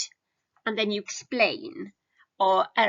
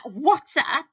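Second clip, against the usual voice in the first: louder, higher casual greeting; casual slang; 5 semitones higher, 5 dB louder than usual. Shown as a demonstration. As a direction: throw it out slangy and loose. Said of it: "So that".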